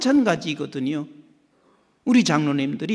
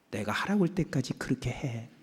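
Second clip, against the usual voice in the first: quiet, lower steady command; calm firm answer; 5 semitones lower, 10 dB quieter than usual. Retold or imitated. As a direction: settle it softly. God replies calmly.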